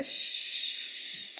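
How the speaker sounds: a sharp whispered shh; librarian shushing whisper. Real, not acted. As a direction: hush someone with a whispered shh. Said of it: "Are you shushing me right now?".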